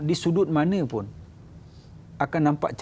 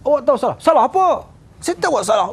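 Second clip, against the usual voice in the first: higher, louder barked accusation; a boss shouts at a subordinate, higher and louder; angry scolding; 9 semitones higher, 5 dB louder, faster than usual. Retold or imitated. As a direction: yell at the man to admit his fault. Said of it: "Tapi kalau di sana kita marah".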